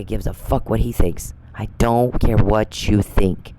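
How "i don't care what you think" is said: very slow, each word set down; slow, deliberate defiance. Real, not acted. Speaking straight to Charles, slow and defiant. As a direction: spell it out slowly so he can't miss it.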